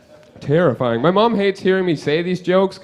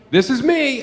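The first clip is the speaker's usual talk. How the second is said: mock kid outburst; 9 semitones higher than usual. Kids screaming at the newcomer.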